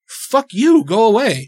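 loud, high "fuck you, go away"; angry retort. Retold or imitated. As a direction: shout him off. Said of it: "I'm like".

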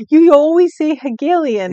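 high, loud playful accusation; teasing. Real, not acted. Co-host catching friend's favourite word, teasing. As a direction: call out the pet word, teasing.

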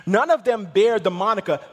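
loud, driving list into a proclamation; full-force preaching. Real, not acted. Preacher sets Jesus apart from the prophets, full-force preaching. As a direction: hammer out each name, then lift the contrast.

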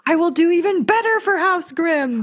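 high, grand boastful vow; pompous kid voice. Retold or imitated. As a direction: declare it like royalty.

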